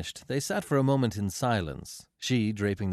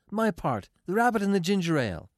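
high, quick and businesslike; brisk demand; 10 semitones higher, 4 dB louder than usual. A narrator's reading. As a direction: rattle it off briskly in a raised pitch. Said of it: "she demanded briskly".

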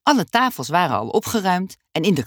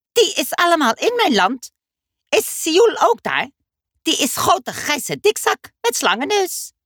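put-on much higher, eager little boy; Dummy's high child voice; 12 semitones higher, 3 dB louder than usual. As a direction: squeak it out like an impatient kid. Dummy's voice in story. (hoge kinderstem, ongeduldig)

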